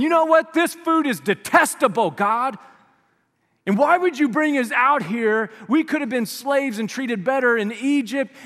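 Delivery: hammed-up griping Israelites; whining complaint. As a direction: complain like spoiled kids. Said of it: "start complaining and whining".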